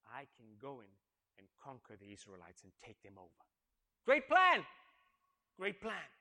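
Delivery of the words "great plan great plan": sarcastic loud great plan; mock the king's glee, loud. Preacher mocks king's scheme.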